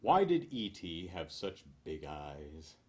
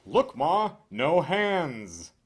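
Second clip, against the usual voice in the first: put-on loud, high, proud kid-style shout; calling out to mother; 8 semitones higher, 10 dB louder, much slower than usual. Digital clock bragging to its mother, calling out loud and high. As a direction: yell it out to show off to Ma.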